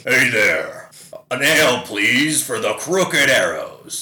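loud booming tavern call; hearty giant. Pretend. Giant warrior orders ale in a tavern, booming loud. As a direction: bellow it like a big drinker ordering ale. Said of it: "he would say".

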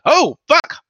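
high, loud "uh-oh" reaction; retold alarm. Retelling his reaction to a coworker's question, mock alarm. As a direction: act out a jolt of dread.

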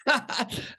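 breathy high-pitched laugh; quick airy giggle. Real, not acted. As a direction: laugh out in delight. Laughs at the irony.

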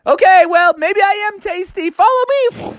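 very high, frantic cartoon voice; panicky Froggy. A narrator's reading. Froggy luring hungry mosquitoes after him, high and frantic. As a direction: squeak it while fleeing.

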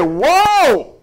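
high, drawn-out whoa; alarmed exclamation. Retold or imitated. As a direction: call a startled halt. Retelling alarm at shaking car.

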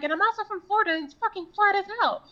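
high, loud, fast complaint; exasperated rant. Retold or imitated. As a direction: blurt it out, fed up and out of breath. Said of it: "And I'm like".

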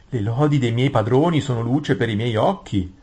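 lightly voiced, flattering vizier; Gran Visir ossequioso. (visir adulatore, tono un po' più alto)

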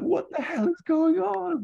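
squeaky high, bewildered yelp; panicked Ferrell. Retold or imitated. Ferrell confronting Hoggle in the alley, squeaky panicked shriek. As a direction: shriek it out in total disbelief. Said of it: "Like that".

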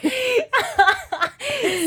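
high burst of laughter; friend's joke sets off a loud, high laugh. Real, not acted. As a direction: let a big real laugh out.

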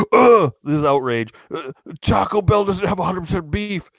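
high, whiny parody of a complainer; exaggerated indignant voice; mock outrage. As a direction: imitate someone scandalized by fast food. Mocking outraged complainers about beef.

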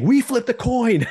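high, slow, insistent mock whine; imitating Jesse. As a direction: stretch it out like a sacred rule. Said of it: "he keeps saying".

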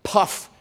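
"puff" with a popped P; sharp P. Shown as a demonstration. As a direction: isolate "puff" and burst the P. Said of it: "With a sharp P".

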